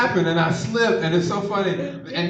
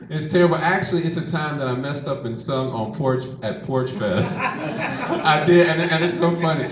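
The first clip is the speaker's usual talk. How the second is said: usual speaking voice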